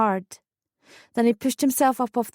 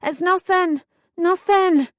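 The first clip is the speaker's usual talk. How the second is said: high, scared shout back; loud, high, frightened denial; panicked shout; 9 semitones higher, 4 dB louder, slower than usual. A narrator's reading. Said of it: "I shouted back, panicked and scared".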